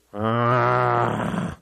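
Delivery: low, reluctant mock groan; teenager's groan.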